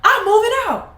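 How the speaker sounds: put-on high, loud child's declaration; high defiant kid voice. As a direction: announce it like a kid storming off. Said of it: "I was like".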